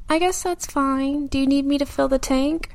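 hesitant fake agreement; passive reluctant. Shown as a demonstration. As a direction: pretend it's fine while annoyed. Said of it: "a passive example would be you saying".

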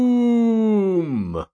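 high theatrical shout of the name; dramatic villain announcement. Acted. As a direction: proclaim it like a comic villain. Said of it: "I don't know why I did that either".

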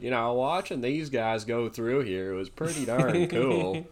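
slow Southern drawl, imitating a driver; imitated Southern accent, drawn out. Retelling how the driver talked at the NASCAR drive, Southern accent. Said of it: "he talked in a Southern accent".

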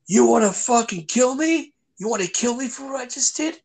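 high, wild taunting of the chef; taunting rage. Retold or imitated. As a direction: goad him, half-crazed and high-pitched. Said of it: "And he's like".

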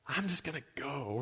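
very soft, acted slinking away; sheepish retreat. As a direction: say it small, as if sneaking out. Guests slinking away from treason party.

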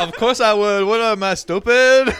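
loud, high, through laughter; mock indignant. Diner answering offer of a to-go box, mock outrage. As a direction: scoff loudly at a silly question. Acted retort to waiter.

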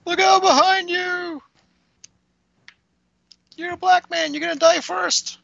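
squeaky high shout at the screen; shrill horror-movie warning falsetto; high mock warning call.

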